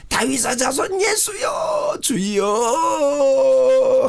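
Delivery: very high, slow, shouting plea to Jesus; a long wailing cry, breaking on 주여; desperate shout. Shown as a demonstration. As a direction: cry out like the blind man begging. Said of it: "이 소경처럼 말이죠".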